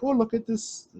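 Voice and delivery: high-pitched mock Japanese girl; light, jokey impression of a Japanese girl. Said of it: "that's my Japanese, um, impression".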